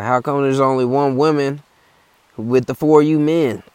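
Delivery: put-on high, loud old hiker bragging; hammed-up high old man; old guy voice. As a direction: put on the old-timer boasting. Old guy voiced in hiking story.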